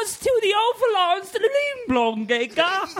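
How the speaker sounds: hammed-up British riddle master; British accent. Podcast friend hams a British accent at the table. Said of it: "Your British accent is the most amazing".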